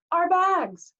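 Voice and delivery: high, loud cry of dismay; Grover alarmed. A narrator's reading. Satyr realizing what was left on the bus, high alarm. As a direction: call out in sudden alarm.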